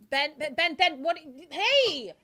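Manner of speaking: loud, high panicked yelling of a name; frantic calling out. Acted. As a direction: shout after a friend being dragged off. Partner calls after dragged friend.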